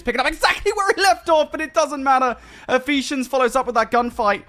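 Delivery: high, loud fast commentary; excited play-by-play. Real, not acted. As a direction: ride the hype of the fight. Hype play-by-play.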